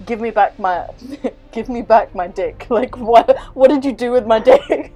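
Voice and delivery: voicing Salem's demand, cracking up; laughing through a quote. Retelling Salem's plea to the god, laughing. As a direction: giggle through the mock demand. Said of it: "and she's like".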